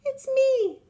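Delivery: very high tiny ant voice; wee small voice. Acted. Tiny ant answering the elephant, wee high voice. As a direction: squeak it up small and cheerful. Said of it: "said a wee small voice".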